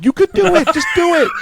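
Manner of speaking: high, loud mock pep talk; hammed-up shouted encouragement; urgent urging. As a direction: egg him on at the top of your voice. Acted urging of the neighbor.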